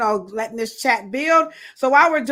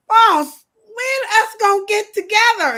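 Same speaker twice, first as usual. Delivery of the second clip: high, loud needy call; mock pestering; 12 semitones higher, 7 dB louder than usual. Getting on the boss's last nerves, pestering. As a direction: pester in an exaggerated pitched-up shout. Playing self pestering the boss.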